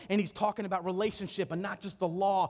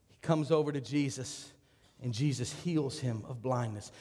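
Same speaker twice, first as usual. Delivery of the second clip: ordinary talk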